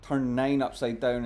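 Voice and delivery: normal talking voice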